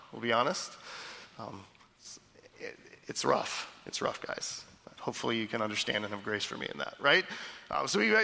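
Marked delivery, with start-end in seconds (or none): 1.4-4.6 whispered, groggy just-woken voice; mock groggy father at two in the morning; groggy sleepy voice. Shown as a demonstration. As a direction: sound half asleep and rough. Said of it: "the way I wake up ... not my best self".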